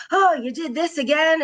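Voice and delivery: a stressed parent at the end of her rope; exasperated. Retold or imitated. Busy stressed parent scolding her child, exasperated. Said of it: "parenting in autopilot".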